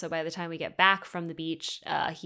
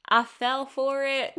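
louder, higher, drawn-out repeat; exasperated admission; 6 semitones higher, 5 dB louder than usual. Real, not acted. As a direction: stretch it out loud in self-mockery.

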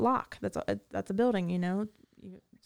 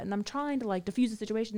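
ordinary delivery

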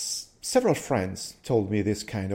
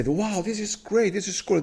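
excited; 10 semitones higher than usual. Retold or imitated. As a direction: gush like you just found something great. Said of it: "I'm excited, and I say".

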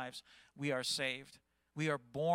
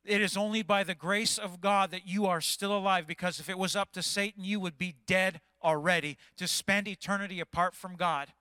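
preaching; 3 semitones higher, 6 dB louder than usual. Real, not acted. Preaching at full force.

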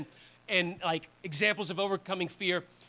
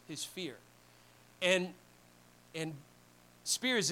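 normal talking voice

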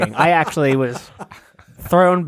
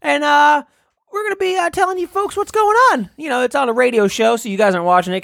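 higher, excited radio announcer, climbing much higher at the floats; parade reporter voice, rising; 10 semitones higher than usual. Acted. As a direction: get more and more worked up pointing at the floats. Continues reporter bit.

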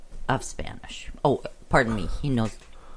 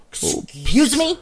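mock offended surprise; 4 semitones higher, slower than usual. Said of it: "And I'm like".

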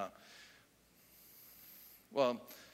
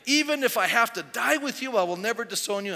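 raised, louder vow, easing at the end; emphatic insistence; 8 semitones higher, 7 dB louder than usual. Retold or imitated. Peter swearing loyalty to Jesus, emphatic insistence. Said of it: "But Peter insisted emphatically".

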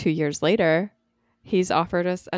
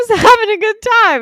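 laughing; 9 dB louder, faster than usual. Real, not acted. Breaks into laughter mid-line.

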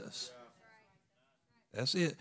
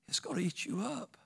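whisper; 8 semitones higher, 8 dB quieter than usual. Acted. Warning about revenge.

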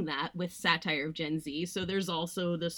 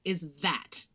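ordinary talk, nothing marked

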